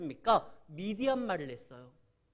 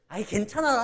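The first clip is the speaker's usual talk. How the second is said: teacher insisting; 4 semitones higher, 8 dB louder than usual. Retold or imitated. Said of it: "그러면 선생님이".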